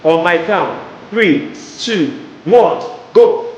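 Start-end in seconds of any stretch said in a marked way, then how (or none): none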